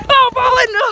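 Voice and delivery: loud, high groan of defeat; loud dismay. Real, not acted. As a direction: cry out as you get caught. Caught in game.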